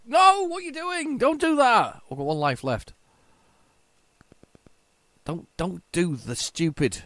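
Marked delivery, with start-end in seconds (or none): 0.1-2.0 high, loud no, stop that; exasperated shout. Real, not acted. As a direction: yell at your own mistake. Yelling at his own character.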